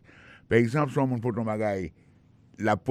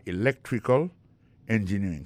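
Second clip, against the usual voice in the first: "electrical" with LEC raised, a pause before "engineering"; second-syllable stress. Shown as a demonstration. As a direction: hit LEC in "electrical", then say "engineering" apart. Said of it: "ça met sur deuxième syllabe là".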